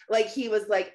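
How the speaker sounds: normal talking voice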